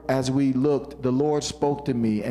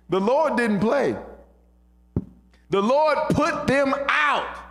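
high, ringing preacher delivery; emphatic scripture preaching; 12 semitones higher than usual. Acted. Preacher declaring the verse to the congregation, emphatic and high. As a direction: build the verse up with force.

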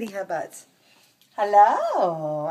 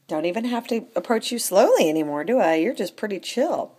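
normal talking voice

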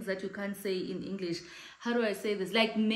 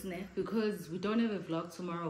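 normal talking voice

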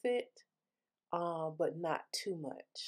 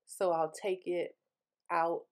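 mouthpiece between lips; teeth-whitening tray in mouth; talking with tray in mouth. Real, not acted. Seen 0: Glowing whitening tray held in mouth, cable hanging, throughout the words.

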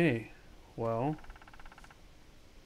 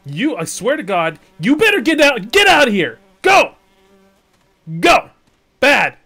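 high, loud, worked-up scolding; exasperated shouting; 8 semitones higher, 14 dB louder than usual. Real, not acted. Gamer driving off a following dinosaur, exasperated shouting. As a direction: yell at a pest to get out.

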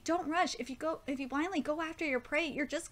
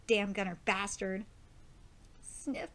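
usual speaking voice

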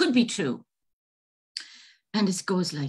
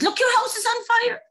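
fake alarm; 3 dB louder than usual. Leprechaun's trick alarm.

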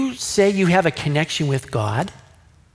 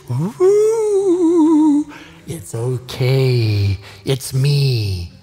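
hammed-up cocky reassurance, drawn out; mock breezy confidence; 4 semitones lower, 4 dB louder, much slower than usual. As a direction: pretend carefree swagger. Daniel mocked as breezily confident.

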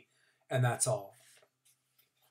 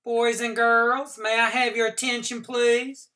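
high, bright classroom call for attention; teacher's announcement voice; 9 semitones higher, 7 dB louder than usual. A narrator's reading. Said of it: "she said".

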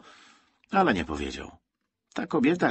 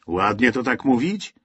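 ordinary delivery

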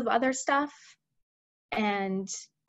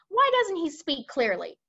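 higher-pitched irritated question; snappish; 6 semitones higher, 3 dB louder than usual. Acted. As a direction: demand it with mild impatience. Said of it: "the head of the army snapped".

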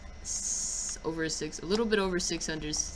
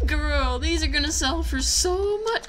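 thrilled; 7 semitones higher, 4 dB louder than usual. Real, not acted. Thrilled about the loot.

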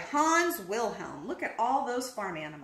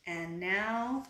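soft bedtime; 4 semitones lower, 8 dB quieter, slower than usual. A narrator's reading. Bedtime book ending.

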